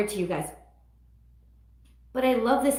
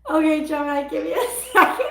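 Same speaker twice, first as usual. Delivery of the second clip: higher, louder, breaking into laughs; laughing; 5 semitones higher, 5 dB louder, faster than usual. Real, not acted. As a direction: giggle through it. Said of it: "I just swallowed a freaking bug".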